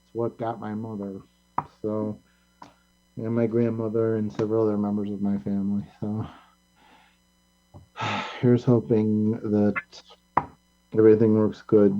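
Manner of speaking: low, subdued, halting grief; real sorrow, voice sinking low and quiet; somber remembrance. Real, not acted. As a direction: speak softly and haltingly of those you lost. Mourns friend and relatives lost to cancer.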